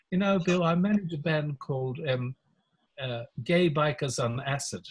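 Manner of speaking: lightly done English public school voice. Retold or imitated. Lawyer introduces himself to Bill in a light English public school accent. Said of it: "so sort of English public school".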